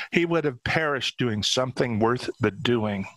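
ordinary delivery, nothing marked

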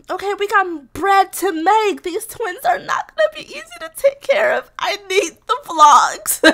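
high, loud, drawn-out whine; whiny pleading. Real, not acted. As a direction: wail your demands like a playful complaint. Mock pleading with the game character.

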